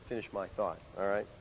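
usual speaking voice

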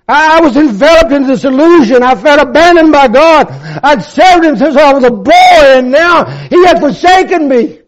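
high, loud pulpit fervor; impassioned sermon climax; full-force preaching. Real, not acted. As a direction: preach it to the back row.

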